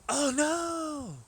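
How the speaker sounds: high, loud "oh no"; alarmed cry. Real, not acted. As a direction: squeal it out in sudden alarm.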